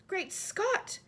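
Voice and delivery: high, sharp exclamation of shock; the queen cries out at the headlines, high; startled cry. Acted. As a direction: burst out in disbelief. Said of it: "cried the famous voice".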